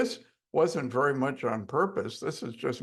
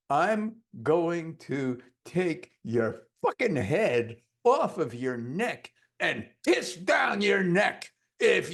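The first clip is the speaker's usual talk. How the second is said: slow menacing command; 4 semitones higher, slower than usual. Retold or imitated. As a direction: threaten with slow force, then shout. Hypnotist gives aggressive suggestion.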